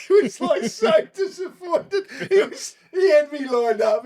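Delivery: laughing. Real, not acted. Telling the interviewer about the sniper, laughing hard. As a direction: laugh it out as you tell it.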